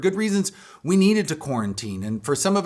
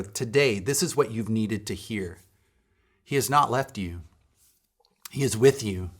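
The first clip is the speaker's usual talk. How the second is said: ordinary delivery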